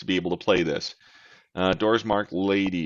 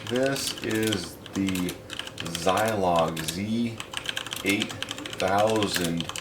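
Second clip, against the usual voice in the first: slow, careful dictation; 5 dB quieter, slower than usual. Shown as a demonstration. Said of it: "let's see how it does".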